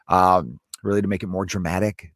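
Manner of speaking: usual speaking voice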